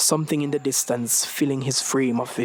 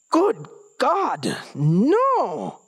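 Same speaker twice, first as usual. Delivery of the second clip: high, loud disgusted cry; horrified shout; 9 semitones higher, 3 dB louder, much slower than usual. Acted. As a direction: yelp it out in disgust.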